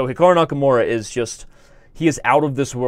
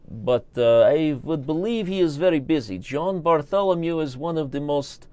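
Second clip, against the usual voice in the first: higher, lilting mock Russian; put-on Russian accent; 3 semitones higher than usual. Streamer calling out a chess challenge, mock Russian accent. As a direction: play it up in a thick Russian accent. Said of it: "I love your accent".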